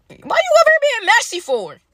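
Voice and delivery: exasperated scolding. Acted. Scolding Peyton in story.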